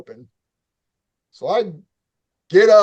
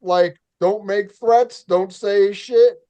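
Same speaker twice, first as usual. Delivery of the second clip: slowly, deliberately cautioning yourself; slow self-warning; 4 semitones higher, slower than usual. Retold or imitated. Narrator warning himself not to threaten, slow inner voice. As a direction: talk yourself down, slow and careful. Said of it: "first thing I thought was".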